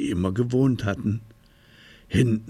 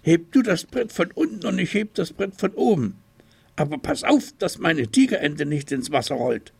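put-on higher, fast childlike voice; a small tiger taking charge; busy little tiger; 8 semitones higher, faster than usual. A narrator's reading. Little tiger deciding to fix the bridge, high quick voice.

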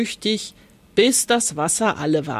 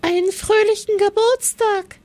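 much higher, squeaking little mouse; squeaky mouse; 12 semitones higher, 3 dB louder than usual. Acted. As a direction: peep out the greeting like a tiny mouse. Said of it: "piepste sie".

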